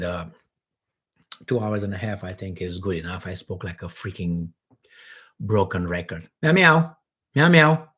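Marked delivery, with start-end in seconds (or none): 6.4-7.9 louder meows to call the cat. Real, not acted. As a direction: meow loudly to get the cat's attention. Calling the cat.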